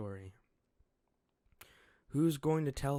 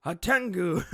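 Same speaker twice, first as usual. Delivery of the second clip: startled shout; 8 semitones higher, 12 dB louder than usual. Acted. Child spots masked man.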